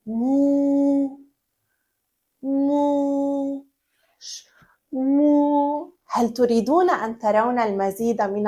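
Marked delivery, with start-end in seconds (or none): 0.1-5.9 soft hushed moo, ending in a whisper; quiet moo. Shown as a demonstration. Teacher asking children to moo quietly, soft hushed moo. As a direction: moo softly, almost a whisper. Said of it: "بصوت منخفض".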